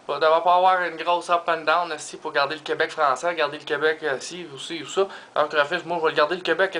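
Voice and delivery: ordinary talk, nothing marked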